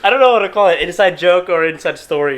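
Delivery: high and loud, laughing through it; embarrassed giggly reply; laughing through the words, high and loud. Real, not acted. Guest laughing, embarrassed at nickname.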